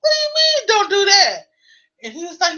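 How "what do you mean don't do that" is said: mock outrage. Her retorted confusion.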